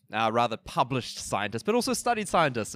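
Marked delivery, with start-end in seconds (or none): 1.7-2.7 jokey, pitched way up; playful high tease. Real, not acted.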